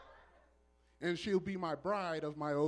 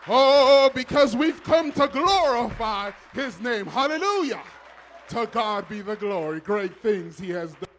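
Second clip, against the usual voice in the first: loud, higher, exultant call to praise; full-force preaching; 5 semitones higher, 13 dB louder than usual. Real, not acted. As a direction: preach it big, rousing the church to clap. Exhorts congregation to praise.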